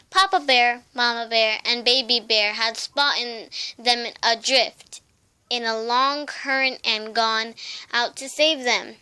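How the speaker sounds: usual speaking voice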